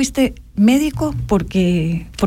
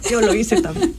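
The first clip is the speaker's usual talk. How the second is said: laughing. Real, not acted. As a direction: repeat "yo lo hice también" through a real laugh. Repeats while laughing.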